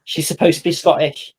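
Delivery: unsure go at a Scottish accent; attempted Scottish accent. Shown as a demonstration. Game master trying it after a friend, shaky Scottish accent. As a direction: copy the Scots accent, imperfectly. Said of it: "I can't do a Scottish accent".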